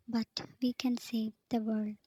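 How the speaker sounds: usual speaking voice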